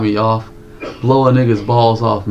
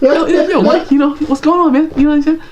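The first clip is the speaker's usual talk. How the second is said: mock falsetto friend, louder and very high; Jeebus; 4 dB louder than usual. Showing the co-host how his friend talks, high falsetto impression. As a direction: exaggerate a friend's high-pitched way of talking. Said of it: "That's how Jeebus talks".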